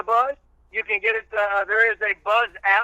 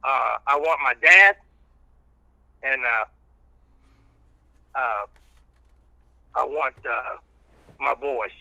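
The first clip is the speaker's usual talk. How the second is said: choked up; 8 semitones lower than usual. Real, not acted. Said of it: "that's gonna make me cry now".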